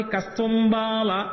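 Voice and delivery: drawn-out Sanskrit word; slow verse recitation. Shown as a demonstration. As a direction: stretch each syllable slowly. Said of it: "అసలు ఏమంటాడో చూద్దామని". (నెమ్మదిగా సాగదీసి)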